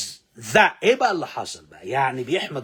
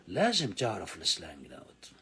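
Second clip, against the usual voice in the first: whisper; 8 semitones lower, 8 dB quieter than usual. Real, not acted. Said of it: "whisper heard".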